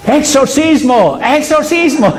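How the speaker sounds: high, drawn-out mock cry; imitated exorcist kid, calling out slow and high; child crying exorcism. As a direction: play a child driving out a demon, hammed-up. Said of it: "¿Saben qué hizo este niño?".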